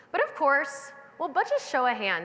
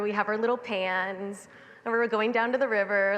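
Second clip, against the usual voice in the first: ordinary delivery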